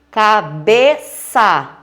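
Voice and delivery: Cabeça very slow and loud, in separate syllables; syllable-by-syllable stress demo. Shown as a demonstration. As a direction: break Cabeça into CA, BE, ÇA, leaning on BE. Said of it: "Bé é a sílaba tônica".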